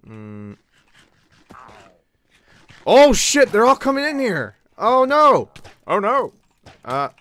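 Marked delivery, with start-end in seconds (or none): 2.9-6.3 high, loud panicked cries as mobs flood in; panicked yelling. Real, not acted. Gamer to the swarming mobs, panicked loud yelling. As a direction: yell in real panic.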